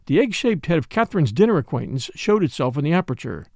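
ordinary delivery

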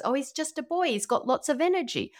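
mock reassurance. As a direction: brush it off in a light, high voice. Voices dismissive pediatrician's reassurance.

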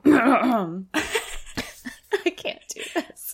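laughing then breathy, can't go on; giddy, losing composure. Real, not acted. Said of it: "I'm so giddy right now".

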